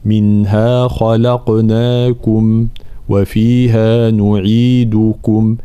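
نعيدكم with lips fully rounded on كم; slow recitation with a clear damma; full damma. Shown as a demonstration. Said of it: "لابد من بيان حركة الضم".

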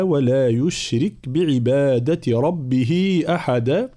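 slow recitation, أحدا ending on a moderately long aa; two-count final madd. Shown as a demonstration. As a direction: chant the verse, closing أحدا without overstretching it. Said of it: "مد العوض لا يزيد على ألف واحدة".

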